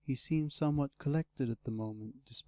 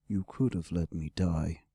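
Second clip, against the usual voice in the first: low, weighty dragon voice; reproachful dragon, pitched well down; great red dragon; 7 semitones lower than usual. Acted. Wounded dragon to his rescuer, low and reproachful.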